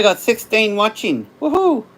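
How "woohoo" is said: high, bright whoop of delight; a quick celebratory whoop; cheer. Real, not acted. Streamer cheering his sixteen live viewers, excited whoop.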